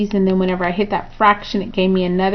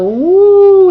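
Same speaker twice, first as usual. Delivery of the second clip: whooshing woo; 11 semitones higher, 8 dB louder than usual. Acted. Tracing domain along the graph.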